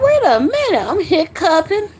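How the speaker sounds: high-pitched, swooping mock disbelief; mock surprise. Tipsy host reacting to own hiccups, mock surprise.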